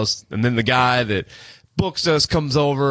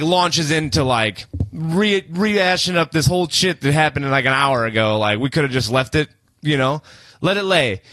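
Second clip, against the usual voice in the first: ordinary delivery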